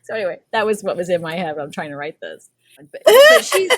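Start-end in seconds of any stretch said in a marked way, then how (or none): 2.8-3.7 squeaky. Real, not acted. Giggly squeal.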